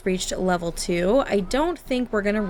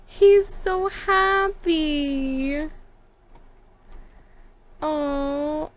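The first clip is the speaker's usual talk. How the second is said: much higher, slow, gushing delight; 11 semitones higher, much slower than usual. Real, not acted. Player gushing over her Sims' joy, much higher and slow. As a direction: coo over them like they're adorable.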